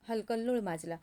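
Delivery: plain reading voice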